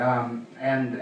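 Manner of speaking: usual speaking voice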